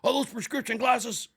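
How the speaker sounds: loud, high shouted question; drill instructor yell. Retold or imitated. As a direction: imitate an instructor yelling in someone's face. Instructor yelling right in his face.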